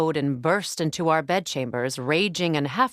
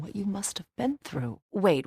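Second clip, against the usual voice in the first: ordinary talk, nothing marked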